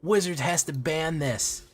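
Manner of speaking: slow, high mock-outraged YouTuber hype; mock clickbait YouTuber. Mocking clickbait YouTubers to his viewers, hammed-up and high. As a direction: ham up a shocked clickbaiter. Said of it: "shock YouTuber face ... and saying".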